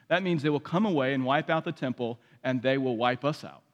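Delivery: ordinary talk, nothing marked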